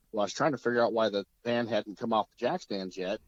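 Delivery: normal talking voice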